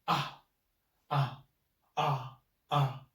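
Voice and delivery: ah dropped hard and strong; strong falling fourth tone. Shown as a demonstration. Teacher demonstrating the strong fourth tone on ah. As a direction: snap each ah downward, strongly. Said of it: "The fourth tone is strong".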